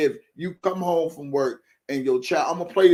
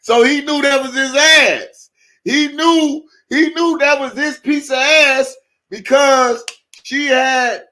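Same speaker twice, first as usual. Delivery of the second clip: high, loud, slow laughing rant; excited disbelief; 11 semitones higher, 8 dB louder, much slower than usual. Real, not acted. Host retelling the reveal to chat, loud and excited. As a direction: shout it out still cracking up.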